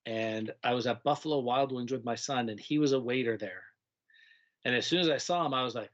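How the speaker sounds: ordinary talk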